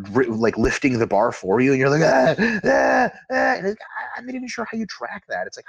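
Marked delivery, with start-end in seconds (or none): 2.0-3.5 high, strained mock grunts of a lifter at failure; mock straining grunts. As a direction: fake the gasps of someone grinding out a rep. Imitating lifter straining past failure.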